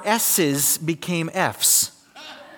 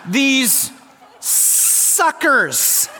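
high, slurred S's through a mouthguard, laughing; mouthpiece lisp; 8 semitones higher, much slower than usual. Shown as a demonstration. Preacher demoing mouthguard lisp, laughing, high. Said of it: "I had my mouthpiece in".

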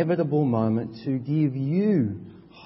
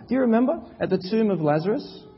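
higher, louder preaching, slowing on the questions; full-force preaching; 8 semitones higher, 5 dB louder than usual. Acted. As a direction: preach with rising urgency.

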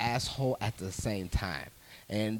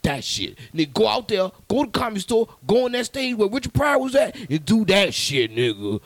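put-on pitched up, pushy old man urging him on; hammed-up older man, higher and louder; older gentleman; 5 semitones higher, 7 dB louder than usual. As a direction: lean in like an insistent older guy hyping a young comic. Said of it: "He's like".

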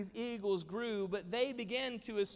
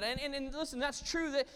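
ordinary talk, nothing marked

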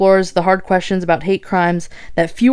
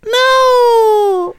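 high playful squeal; squealing protest; 3 dB louder than usual. Real, not acted. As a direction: squeal no at the camera. Camera caught her.

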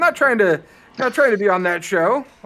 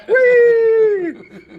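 long, loud, high "whee"; drawn-out high whee of pretend joy; mock gleeful squeal; 6 dB louder than usual. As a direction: squeal it out like a fake thrill. Mock glee over the dancer's chest.

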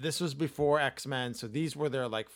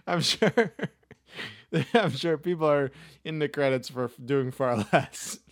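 laughing; 6 dB louder than usual. Real, not acted. Laughing through speech.

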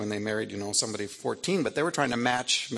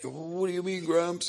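imitated child's whine; 7 semitones higher than usual. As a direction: whine it like a pouting little princess. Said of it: "the whine".

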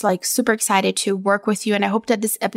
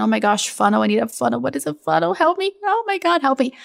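mock panic; 3 semitones higher than usual. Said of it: "the bros keep talking about the funnel".

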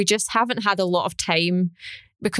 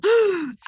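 heavy breath in; 12 semitones higher than usual. Retold or imitated. Imitating co-host before speaking, heavy breath in. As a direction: suck in air audibly, exaggerated. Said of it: "heavily before you go to speak".

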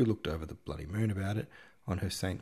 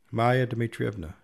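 calling a name across the room, louder; 4 semitones higher, 6 dB louder than usual. Acted. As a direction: call her name across the room to summon her. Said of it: "she called out".

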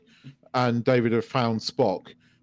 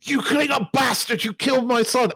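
much higher, yelled accusation; raw angry shout; 10 semitones higher than usual. Retold or imitated. Kirk screaming at the Klingon who killed his son, raw shout. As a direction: let the rage burst out. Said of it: "quite good acting by William Shatner".

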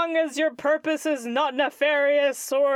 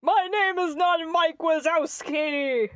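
indignant protest; 6 semitones higher than usual. Acted. Creature denies nickname indignantly.

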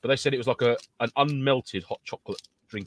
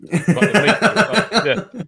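laughing; 9 semitones higher, 8 dB louder, much slower than usual. Real, not acted. Laughing while telling meat story.

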